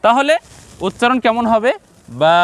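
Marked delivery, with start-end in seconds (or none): 2.2-2.4 বা held long on the aa; long vowel. Shown as a demonstration. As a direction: stretch out the vowel of বা. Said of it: "লম্বা করে উচ্চারণ করতে হবে". (বা লম্বা করে টেনে)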